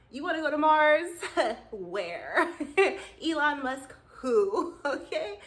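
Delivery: loud, high, cracking with laughter; teasing the audience through giggles; laughing hype. Real, not acted. Hyping Mars, laughing.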